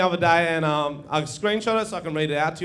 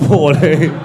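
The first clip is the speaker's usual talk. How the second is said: laughing disbelief; 3 semitones lower, 10 dB louder than usual. Real, not acted. Echoes the boy's age, laughing.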